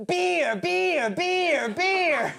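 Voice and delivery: high, falling chant on each word; mocked American girls. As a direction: chant it in a high mock-girl voice. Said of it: "You know American girls. They're like".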